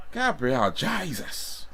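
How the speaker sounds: high, slow mock-Irish exclamation; hammed-up Irish voice; Irish accent. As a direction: stretch it out in an exaggerated Irish accent. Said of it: "That sounds Irish".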